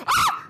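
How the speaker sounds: sudden very high shriek of fright; startled scream. Real, not acted. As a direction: yelp as if something jumped out. Jump scare in horror game.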